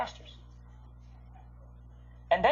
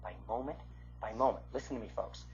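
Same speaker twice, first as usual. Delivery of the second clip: hushed earnest; 8 semitones lower, 7 dB quieter than usual. Real, not acted. Preacher hushing to promise the congregation quietly. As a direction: drop to a near-hush, very soft and earnest.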